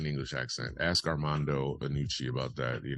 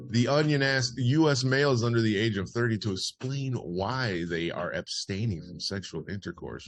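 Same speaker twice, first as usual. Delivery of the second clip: higher, projected opening, settling down; news read; 7 semitones higher, 5 dB louder than usual. Acted. Host reads a parody survey to listeners, news read. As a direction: report the survey like a bulletin.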